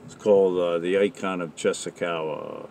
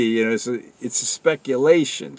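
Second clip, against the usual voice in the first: usual speaking voice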